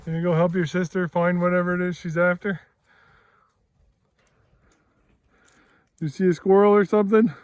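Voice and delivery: high, quick, excited dog talk; playful pet talk. Real, not acted. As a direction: chatter to the dogs playfully. Teasing talk to the dogs.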